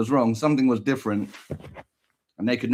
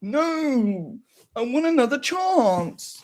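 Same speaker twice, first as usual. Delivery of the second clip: high, slow plea; pleading son; 10 semitones higher, much slower than usual. Pretend. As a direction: whine for one more chance.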